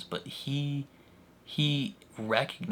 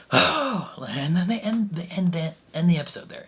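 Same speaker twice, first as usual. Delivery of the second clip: higher, louder, dragged-out groan of disbelief; exasperated; 8 semitones higher, 5 dB louder than usual. Real, not acted. Fan venting about the episode's cliffhanger, high and loud. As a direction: complain like the show just betrayed you.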